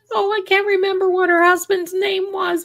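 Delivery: a nosy neighbour peering out the window, high and loud; Mrs Kravitz impression. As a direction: squawk it out like the busybody next door. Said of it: "she talked like this".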